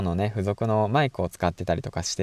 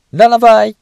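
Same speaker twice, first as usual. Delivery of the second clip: playful sign-off call; 12 semitones higher, 13 dB louder than usual. Real, not acted.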